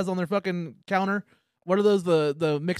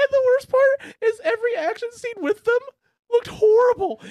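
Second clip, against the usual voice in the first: much higher and louder, indignant laughing rant; excited rant; 6 dB louder than usual. Real, not acted. Friend ranting to cohost about silly chase scenes, high outraged shriek.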